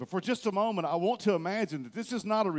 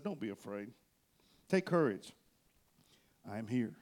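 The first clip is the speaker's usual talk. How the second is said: much softer and lower, gentle; calm reassurance; 8 semitones lower, 7 dB quieter than usual. Acted. Jesus speaks to his frightened disciples, softly reassuring. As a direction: soothe frightened men.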